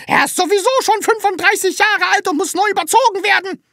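high, fast, loud tirade; loud, shrill-pitched scolding shout; shrieking. A narrator's reading. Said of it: "schrie Frau Rotkohl weiter".

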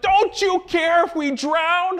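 high, slow, terrified plea; panicked disciple. Retold or imitated. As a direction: beg him as if you're about to drown. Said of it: "and like".

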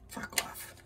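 breathy under-the-breath curse, very soft; whispered curse. Real, not acted. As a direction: hiss it to yourself, barely audible. Muttered aside at the cards.

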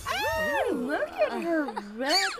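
high, loud gushing admiration. Real, not acted. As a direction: gush over her like a proud fan. Admiring new sim's red hair.

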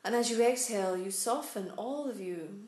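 calm meditation voice, spacious pauses; unhurried, soothing instructions, letting each phrase settle; guided meditation. Real, not acted. Guides breath and body relaxation.

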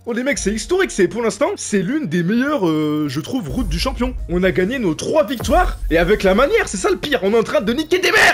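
high, excited boasting; over-the-moon joy; euphoric. Real, not acted. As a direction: celebrate loudly. Post-win euphoria.

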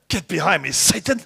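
loud, forceful rebuke; stern rebuke. Acted. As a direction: drive him back with a loud command. Jesus rebuking Peter.

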